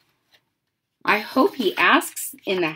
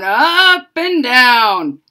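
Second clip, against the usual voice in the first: "up and down" drawn out slowly and a bit louder, each word held; slow, stretched up and down; 4 semitones higher, 9 dB louder, much slower than usual. Shown as a demonstration. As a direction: stretch out "up and down" like the wire jiggling. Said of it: "jiggled it up and down".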